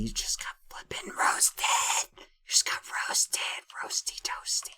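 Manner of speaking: breathy, voiceless gloating whisper; hushed, playful mockery; whispered taunt. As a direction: whisper a teasing burn. Whispered taunt after mocking Quackity.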